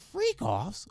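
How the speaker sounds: "freak offs" pitched high like a question; mocking over-emphasis. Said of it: "Why you gotta say it like that?".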